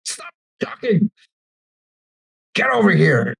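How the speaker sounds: mean guard, sharp order held down in volume; strained, hushed command from a guard. Acted. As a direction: cut them off like a surly jailer. Mean guard cutting in on prisoners.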